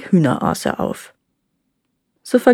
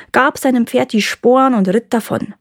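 ordinary delivery, nothing marked

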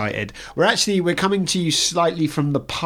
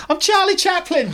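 mock indignant; 5 dB louder than usual. Mock protest when ushered away.

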